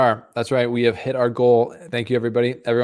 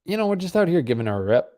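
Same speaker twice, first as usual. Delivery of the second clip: a hammed-up Canadian accent, high and quick; hammed-up Canadian dude, pitched high and fast; 7 semitones higher, faster than usual. Greeting stream viewers in a jokey high-pitched Canadian accent. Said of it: "full Canadian accent".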